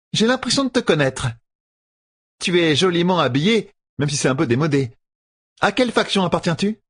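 marchande aiguë et mielleuse; a sly merchant woman, pitched high; voix de marchande. Acted. Renel interroge Rand. (voix aiguë de marchande rusée)

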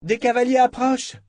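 high lookout's call; sudden cry. A narrator's reading. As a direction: call out the alarm, pitched high. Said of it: "cria soudain Estelle".

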